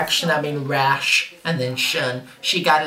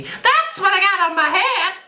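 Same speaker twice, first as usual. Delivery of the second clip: very high, loud comic shriek; high-pitched outburst; 4 dB louder than usual. Retold or imitated. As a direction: explode into a piercing, hammed-up squeal. Escalating quoted rebuke, comic outburst.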